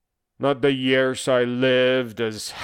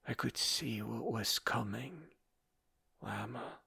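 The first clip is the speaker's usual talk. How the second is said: very soft, grave aside; quiet and intimate, barely voiced; hushed reproach; 17 dB quieter than usual. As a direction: drop almost to nothing. Grave aside to his sister.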